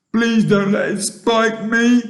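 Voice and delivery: high, loud frightened plea; pitiful high-pitched begging. A narrator's reading. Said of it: "begged the earthworm".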